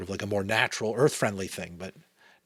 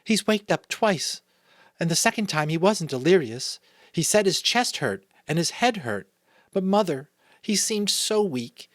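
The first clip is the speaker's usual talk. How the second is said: put-on higher-pitched child reporting to Mother; child's voice; 11 semitones higher, 6 dB louder than usual. A narrator's reading.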